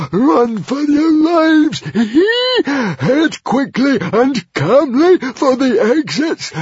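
very high screams broken by mad laughter; screaming hysterically. A narrator's reading. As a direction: shriek slow, stretched-out orders while cackling helplessly. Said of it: "laughing and itching and screaming hysterically".

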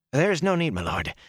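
quick high protest; startled protest. Acted. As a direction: protest in surprise. Servant protests to lord.

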